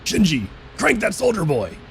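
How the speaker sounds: high put-on snake voice. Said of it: "I did the snake voice for that".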